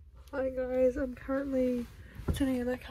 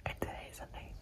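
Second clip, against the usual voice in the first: late-night whisper. Real, not acted.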